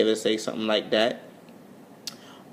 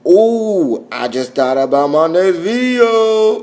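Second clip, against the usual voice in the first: loud, high, drawn-out exclamation; excited outburst; 7 semitones higher, 11 dB louder, much slower than usual. Pretend. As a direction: stretch it out loud and rising, thrilled by an idea. Sudden idea, called out drawn-out.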